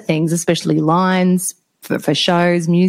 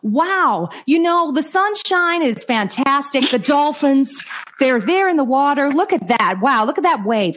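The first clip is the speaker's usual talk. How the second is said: mock American enthusiasm, higher; imitating an American; 8 semitones higher, slower than usual. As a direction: do the booming American on the jetty. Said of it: "a guy going".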